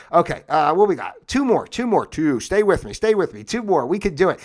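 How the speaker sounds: loud, pumped-up spotter counting down the last reps; gym coach. Pretend. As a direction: push them through the final two like a trainer. Pushing through reps like coach.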